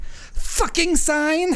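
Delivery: put-on very high, very slow, cursing in a Ukrainian accent; imitated Ukrainian accent. Seller cursing the flapping sale sign, high, slow, hammed-up Ukrainian accent. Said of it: "I wish I could do his accent".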